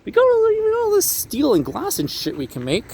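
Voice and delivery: high, mock-exasperated architect pitching glass and steel; imitating Mies van der Rohe. Architect scoffing at Romanesque buildings, high mocking voice. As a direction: squeak it out like a fed-up modernist, pitch way up. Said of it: "and he was like".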